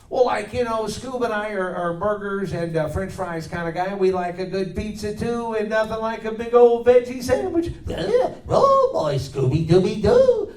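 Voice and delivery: high, excitable slacker voice rising to a giggle; high goofy cartoon voice, ending in a giggle; goofy cartoon sidekick. Answers in cartoon character voice.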